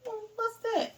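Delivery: high startled what's that; sudden alarm, squeaked up high; surprised high question. Retold or imitated. Retelling seeing the roach, high surprise. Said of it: "And I was like".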